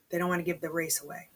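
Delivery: usual speaking voice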